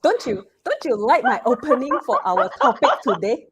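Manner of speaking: ordinary talk, nothing marked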